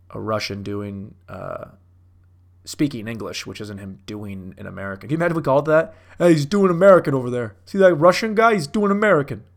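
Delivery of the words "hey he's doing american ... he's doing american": loud, higher mock onlooker call; mock jeering. Imagined onlooker points out the Russian guy, mock jeering.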